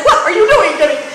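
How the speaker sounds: high, loud mock scolding; hammed-up alarmed reproach; shocked onlookers. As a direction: imitate appalled friends calling her out. Imagined onlookers scold her, shocked.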